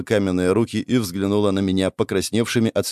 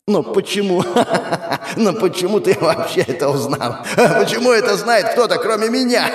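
hysterical laughing; 10 semitones higher than usual. Acted. Erishkigal's hysterical laughing questions.

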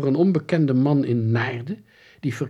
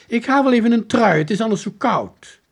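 put-on high, loud girl's voice; keen, raised voice; eager announcement; 11 semitones higher, 8 dB louder than usual. A narrator's reading. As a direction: pipe up loudly, full of purpose. Monica hurries off for a sweater.

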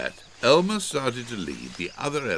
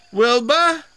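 high, loud call to someone hidden; raised, carrying voice; 9 dB louder than usual. A narrator's reading. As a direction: call the name out loudly. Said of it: "called Elmer".

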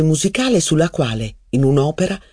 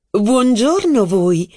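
high, slow cooing; cooing greeting; 7 semitones higher, much slower than usual. Acted. Mistress cooing greeting to Robert over champagne. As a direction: coo it like a tender joke. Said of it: "ripetendo questa formula".

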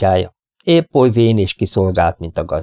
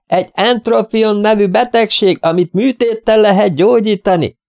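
shouting; 13 semitones higher, 3 dB louder than usual. A narrator's reading. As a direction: bellow an explanation at deaf ears, high. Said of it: "ordítottam". (magas hangon ordítva)

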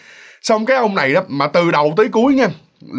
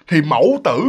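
ordinary delivery

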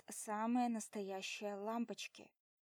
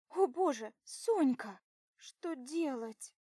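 loud panicked cry; 4 semitones higher, 8 dB louder, slower than usual. Acted. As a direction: cry out in alarm. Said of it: "вскрик Линки".

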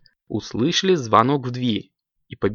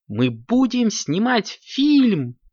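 high, slow, drawn-out announcement; excited announcement; 11 semitones higher, much slower than usual. A narrator's reading. Kittens share their news with mum, high and excited. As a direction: proudly break the big news.

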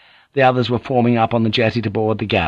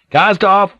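high, loud shouted command; shouted order; 11 semitones higher, 6 dB louder than usual. A narrator's reading. Captain calling to the galley crew, loud shout. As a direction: bellow it across the deck. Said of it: "he shouted".

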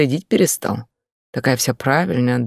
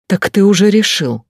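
raised, higher indignant question; angry challenge; 5 semitones higher, 4 dB louder than usual. Acted. Wife challenging shouting husband, raised and indignant. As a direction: fling it at him.

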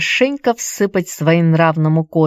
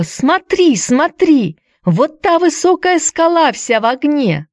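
excited cry; 10 semitones higher than usual. A narrator's reading. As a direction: call out in wonder at the sunset. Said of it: "вскочила с криком". (восторженный крик девочки)

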